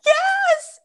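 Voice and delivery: very high, drawn-out cry of delight; real high squealed confirmation; excited squeal. Real, not acted. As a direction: squeal it back in shared excitement. Shares the excitement over Eddie.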